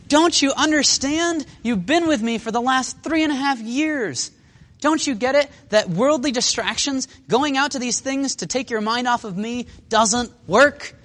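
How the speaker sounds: high, slow, stretched-out reproach; acted plea to make them understand; exasperated pleading. Jesus to his disciples, exasperated pleading.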